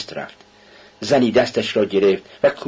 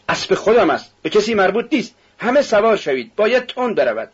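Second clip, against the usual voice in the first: high, insistent, heated retort; defiant outburst; 10 semitones higher than usual. A narrator's reading. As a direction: snap back high at the hecklers. Carter defies the protesting crowd. (پاسخ زیر، تند و لجوجانه)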